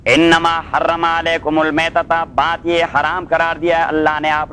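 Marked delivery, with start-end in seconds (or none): 0.1-2.1 slow, drawn-out chanted recitation; slow Quran recitation. Shown as a demonstration. Teacher reciting the verse to his students, slow chanted recitation. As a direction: intone the verse slowly, stretching each word.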